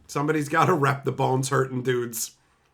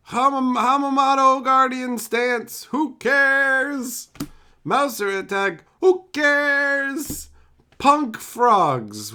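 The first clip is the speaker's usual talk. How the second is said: loud, higher dismissive card callouts; mocking card callouts; 9 semitones higher, 5 dB louder, slower than usual. Real, not acted. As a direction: scoff loudly at each card. Mocking cards while opening pack.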